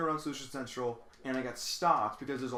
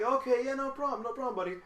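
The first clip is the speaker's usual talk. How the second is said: much higher, eager and sweet; mock cheerful new guy; 10 semitones higher than usual. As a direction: play the overly willing new guy. Mock eager reply to lazy coworker.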